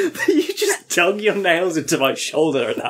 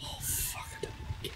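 whispering; 20 dB quieter, faster than usual. Real, not acted. Scared, whispering.

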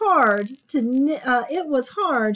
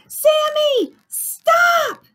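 put-on high, loud children's yell; shriek-high shout to halt him; 10 dB louder, much slower than usual. A narrator's reading. Children yelling at Sammy to stop, loud. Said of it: "yelled the children".

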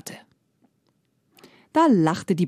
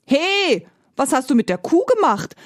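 indignant shout; 7 semitones higher than usual. Acted. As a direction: cry out in outrage. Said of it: "rief der Bauer empört".

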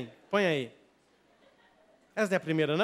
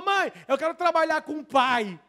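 put-on higher-pitched eager boy; lightly voiced eager little boy; child's voice; 6 semitones higher than usual. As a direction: call to mom like an eager boy. Voicing boy asking mother.

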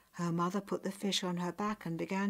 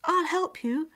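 put-on high, loud girlish reply; high-pitched, hasty willingness; quick, eager offer; 10 semitones higher, 7 dB louder than usual. A narrator's reading. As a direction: jump in to help at once. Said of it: "said Nyakwe quickly".

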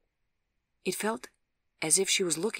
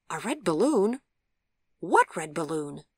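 louder, baffled questioning; puzzled; 6 dB louder than usual. A narrator's reading. Visitor asking the patient about a balloon, puzzled. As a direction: thrown by the odd remark. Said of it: "asked Gregory, puzzled".

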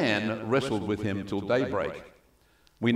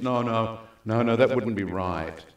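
ordinary talk, nothing marked

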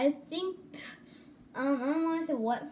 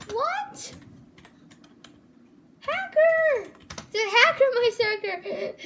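much higher, louder outraged yell; shouting; 11 semitones higher, 7 dB louder, slower than usual. Real, not acted. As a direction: yell it out in alarm. Spots a hacker, yells.

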